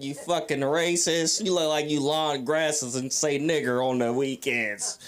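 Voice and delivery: normal talking voice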